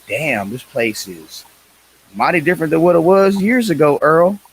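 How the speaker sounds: drawled hillbilly race-fan voice, rising loud. Pretend. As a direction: play a good ol' boy gawking at the crowd. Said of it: "the way you said it with that accent".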